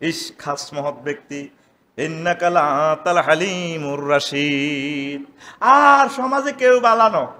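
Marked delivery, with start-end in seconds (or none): 2.0-5.3 slow chanted Arabic; Quran recitation. Acted. Preacher reciting a verse, slow chant. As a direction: chant it slowly.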